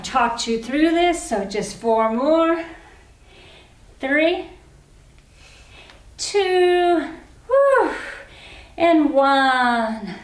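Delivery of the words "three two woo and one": building count into a woo; excited countdown. Real, not acted. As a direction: cheer them over the finish. Countdown to end of squats.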